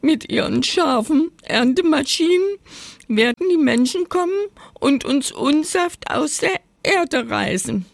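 high, whimpering mock tears; hammed-up, high-pitched weepy complaint; tearful lament. A narrator's reading. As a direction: wail it as a tearful potato. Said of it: "klagte unter Tränen".

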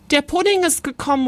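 put-on high, rapid boyish cry; excited call. A narrator's reading. Boy spotting giant puddings, calling out excitedly. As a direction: blurt it out quickly and high. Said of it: "rief er".

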